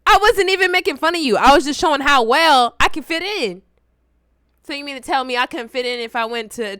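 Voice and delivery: normal talking voice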